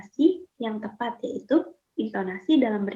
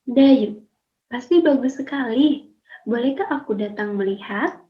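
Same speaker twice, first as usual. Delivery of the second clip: put-on louder, lively read-aloud of a two-girl dialogue for pupils; 2 semitones higher, 5 dB louder than usual. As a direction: perform the dialogue for the class so they can copy it. Teacher reads dialogue aloud. (membaca dialog dengan lantang dan ekspresif)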